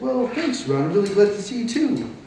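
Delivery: lightly voiced mom, pitched well up; imitating mom. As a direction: lift the voice into a light mom impersonation. Imitating mom replying to him.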